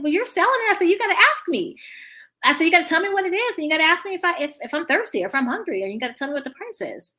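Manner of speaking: put-on high, loud instruction to a child; acted animated teaching moment; emphatic coaching. Acted lesson to the boy.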